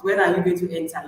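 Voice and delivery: ordinary talk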